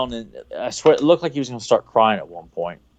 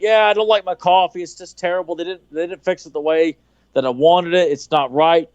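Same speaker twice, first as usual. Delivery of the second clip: higher, whiny mock complaint about the coffee; complaining Arthur; 6 semitones higher than usual. Arthur grumbling to the staffer about his coffee, mock whiny. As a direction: whine it like a fussy star.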